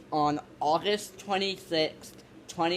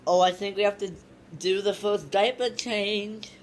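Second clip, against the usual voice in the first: ordinary talk, nothing marked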